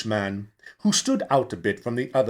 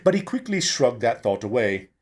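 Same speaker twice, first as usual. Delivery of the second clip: plain reading voice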